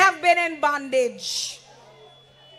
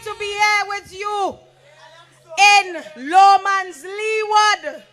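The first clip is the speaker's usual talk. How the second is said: booming motivational speech; 4 semitones higher than usual. Acted. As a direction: fire up the crowd from the stage. Candidate opens rally speech.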